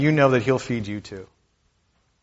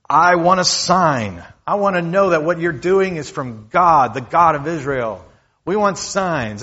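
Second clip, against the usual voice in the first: loud, higher-pitched confrontational challenge; attacking demand; 4 semitones higher, 4 dB louder than usual. Acted. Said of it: "They start attacking Jesus and saying".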